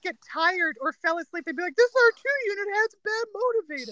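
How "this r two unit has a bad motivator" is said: higher-pitched movie-line imitation; mock announcement in a raised, put-on voice; teasing family quote. Siblings teasing sleepy little Kevin with a film line, mock complaint. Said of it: "like, when Kevin slowed down".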